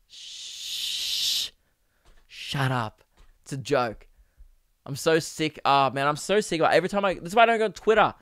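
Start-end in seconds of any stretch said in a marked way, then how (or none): 0.1-4.1 unvoiced hush, then a low, soft "shut up"; imitating a quiet telling-off; mock shushing. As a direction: shush them down like a hushed scold. Shushing an annoying heckler.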